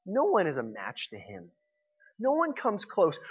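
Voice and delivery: normal talking voice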